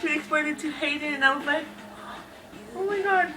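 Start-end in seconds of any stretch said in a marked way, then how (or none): 2.7-3.3 high-pitched fangirl gush; overwhelmed with feels, pitch soaring. Retold or imitated. Reader reliving the tension between two characters, gushing.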